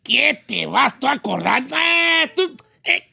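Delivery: high singsong Michoacán reply; hammed-up Michoacán ranch talk with stretched exclamations; Michoacán accent. Returning friend answers the vato from the rancho, Michoacán accent. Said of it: "¿Y cómo le responde el otro?".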